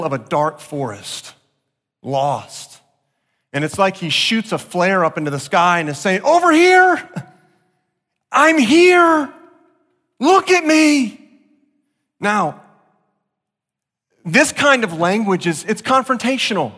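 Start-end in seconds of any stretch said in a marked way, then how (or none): 6.2-11.2 high, loud desperate call for attention. Retold or imitated. Preacher voicing David calling to God like a lost man, loud.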